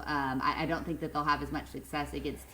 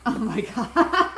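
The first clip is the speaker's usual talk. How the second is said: slow, loud laugh-out over "Oh my God"; real laughter at the cohost's joke; 13 semitones higher, 7 dB louder, much slower than usual. Real, not acted. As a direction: break up laughing. Cracking up at the joke.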